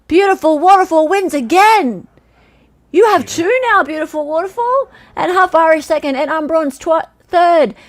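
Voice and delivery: high, loud winner's call; excited announcement. Real, not acted. Host announcing a repeat winner to the chat, excited. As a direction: proclaim the champion with real excitement.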